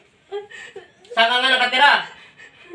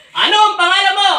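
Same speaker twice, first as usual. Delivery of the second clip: Ano ang pangalan mo, louder and higher, commanding; forceful demand; 8 semitones higher, 5 dB louder than usual. Real, not acted. Exorcist demanding the spirit's name. (malakas na utos sa espiritu)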